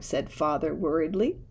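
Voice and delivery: plain reading voice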